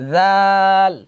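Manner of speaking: long held model dal; drawn-out dal. Shown as a demonstration. Teacher stretching দাল slowly for the class to repeat. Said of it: "আমার সাথে সাথে বলবেন".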